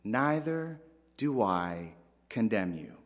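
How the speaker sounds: tender reassurance. Retold or imitated. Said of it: "Jesus saying".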